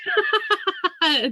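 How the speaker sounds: a big burst of real laughter; loud laugh. Real, not acted. Cracking up at a friend's joke, big laugh.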